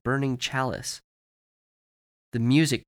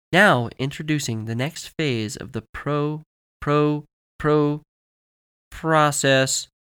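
higher presenter voice stuck in a loop; glitching commercial voice; 4 semitones higher, 3 dB louder, slower than usual. Acted. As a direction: skip like a stuck recording, louder on each repeat. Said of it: "The video was suddenly caught in a loop".

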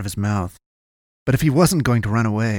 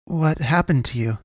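higher-pitched probing question; trying once more; 6 semitones higher than usual. A narrator's reading. Boy questioning the wounded man, trying once more. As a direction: probe in a raised pitch, without pushing. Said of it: "he tried again".

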